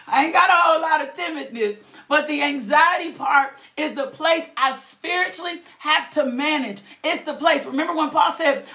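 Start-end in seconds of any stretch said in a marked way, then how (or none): none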